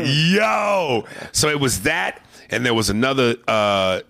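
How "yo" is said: high surprised shout; higher startled shout. Real, not acted. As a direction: shout in delight. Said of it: "I just was like".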